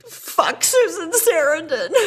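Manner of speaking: high, drawn-out tearful wail; mock crying. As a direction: burst into comic tears mid-curse. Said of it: "and starts to cry".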